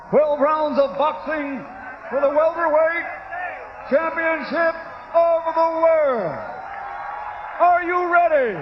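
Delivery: booming, drawn-out arena announcement; ring announcer. Acted. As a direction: stretch every word for the crowd. Ring announcer builds the crowd.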